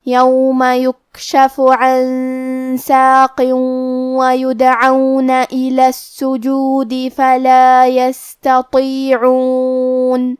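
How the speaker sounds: slow melodic tajweed chant; measured Quranic recitation. Shown as a demonstration. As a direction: recite the verses slowly with long held vowels. Recites the verses.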